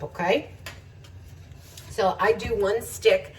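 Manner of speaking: usual speaking voice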